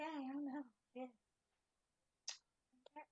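ordinary delivery

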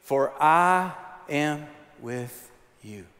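very slow, each word drawn out; slow and deliberate recitation; slow call and response. Shown as a demonstration. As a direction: lead the congregation slowly. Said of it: "can you say that with me".

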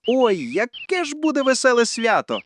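happy storybook squirrel cheer; joyful exclamation. A narrator's reading. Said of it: "радісно вигукнула вона".

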